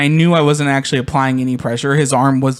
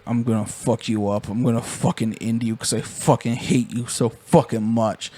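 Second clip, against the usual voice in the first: low, soft mock threat; 4 semitones lower, 5 dB quieter than usual. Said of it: "And he's like".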